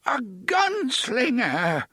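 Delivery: put-on excited old man crying out, voice cracking; cracked excited cry. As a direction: burst out in cracked wonder. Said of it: "His voice cracked and wavered with excitement".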